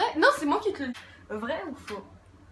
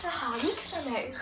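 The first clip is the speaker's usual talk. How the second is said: snooty upper-class lady voice; posh bourgeois voice. Acted. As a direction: say it like a prim bourgeoise. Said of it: "avec une voix de, de bourgeoise".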